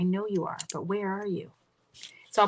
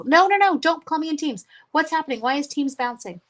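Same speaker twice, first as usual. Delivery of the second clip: high, loud panicked no no no; startled protest at a surprise call; real alarm at the computer; 7 semitones higher, 6 dB louder than usual. Real, not acted. Teams call pops up unexpectedly.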